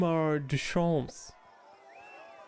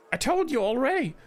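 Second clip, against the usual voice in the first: higher, louder snapping gnome; the gnome loses patience and snaps; angry gnome; 9 semitones higher, 5 dB louder, faster than usual. Acted. Gnome merchant snapping at the queen in anger. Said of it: "The gnome's voice rises in anger".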